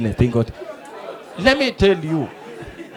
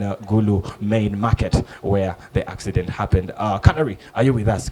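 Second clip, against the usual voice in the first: low, softer news studio voice; news anchor; 10 semitones lower, 6 dB quieter than usual. Retold or imitated. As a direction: play a TV anchor, low and even. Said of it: "The gentleman was there".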